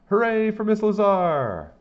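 high, loud, slow cheer. A narrator's reading. Kids cheer for Miss Lazar, high, loud and slow. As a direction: stretch the hooray like a crowd.

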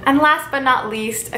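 ordinary talk

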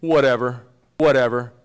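casual brush-off Whatever; dismissive whatever. Shown as a demonstration. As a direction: toss off Whatever as if it means nothing. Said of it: "when we say".

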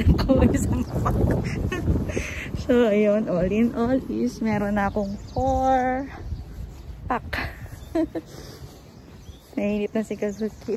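muffled behind mask; speaking while walking outdoors; through a face mask. Real, not acted.

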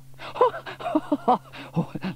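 high, loud delighted laugh; hearty laugh. A narrator's reading. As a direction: laugh at the noisy machine. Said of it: "Laughed Sam".

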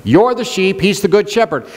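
ordinary delivery

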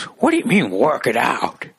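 hammed-up old-guy indignation; imitating angry old man. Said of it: "they turn the fear into anger".

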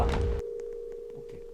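unvoiced whisper; whispering while hiding. Real, not acted. Hiding, waiting.

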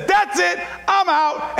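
a loud triumphant cry of done. Retold or imitated. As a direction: call it out like a victor. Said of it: "he said it in a loud voice".

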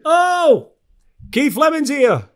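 high, loud excited call. Real, not acted. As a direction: call out to someone on a bad line. Calling to guest joining the call.

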